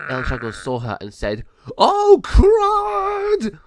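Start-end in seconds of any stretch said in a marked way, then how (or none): 1.8-3.6 very high, loud gargoyle yelp; panicked yelp. Acted. Gargoyle seeing the giant raven, high loud yelp.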